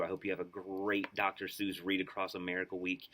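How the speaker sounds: plain reading voice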